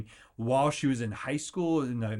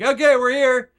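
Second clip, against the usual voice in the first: much higher, much louder declaration; triumphant shout; 11 semitones higher, 11 dB louder than usual. Retold or imitated. Getting back up after life knocks us down, shouting. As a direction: announce you're back, full voice.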